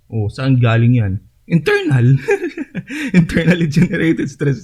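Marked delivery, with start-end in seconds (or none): 1.4-3.2 laughing. Real, not acted. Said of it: "Sorry, ganun". 3.2-4.6 half-giggling retry of the term; laughing through it. Real, not acted. Lecturer repeats the term to listeners, still laughing. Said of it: "Sorry, ganun".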